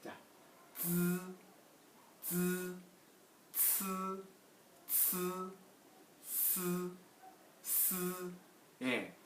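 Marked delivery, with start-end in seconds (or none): 0.8-7.9 쯔, 츠, 스 each drawn out on a high level tone; first-tone demo. Shown as a demonstration. Teacher models 쯔, 츠, 스 for learners, first-tone demo. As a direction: hold each syllable long and level, high. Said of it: "일단 다 일성으로 발음하니까".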